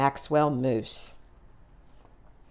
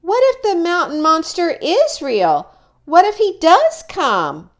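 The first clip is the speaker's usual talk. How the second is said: higher, louder worried call after mom; anxious call; 11 semitones higher, 6 dB louder than usual. Acted. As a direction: call her back, scared the monster is real. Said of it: "Maxwell Moose called out".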